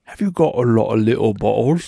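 loud, hammed-up English accent; comically broad English accent, belted out. Shown as a demonstration. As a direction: put on a badly faked English accent. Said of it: "only way I can do an English accent".

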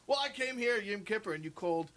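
high, loud aggrieved complaint; indignant complaint. Retold or imitated. Visitor complaining to the sexton, mock aggrieved. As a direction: build the grievance, hammed-up. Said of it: "He's like".

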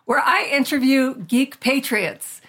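loud, upbeat show opening; podcast intro. Acted. Host opening her interview podcast, upbeat announcing.

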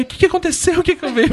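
high, teasing put-on innocence; mocking father. Father mockingly asking what happened, teasing. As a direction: play dumb like the joking dad. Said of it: "e pai".

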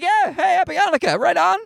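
high, friendly mock-cheerful greeting; pretend-jovial holiday well-wisher; cheery. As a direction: beam at the stranger like a pal. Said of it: "I'm like".

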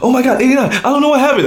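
high, frantic panicked DJ; mock panic, voice jumping way up. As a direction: squeal it like a DJ whose song just died. Panicked DJs quoted.

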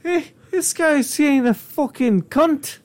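bad Irish accent. Co-host voices clurichaun judging a cellar owner, bad Irish accent. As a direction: put on a rough attempt at Irish. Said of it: "the worst Irish accent right now".

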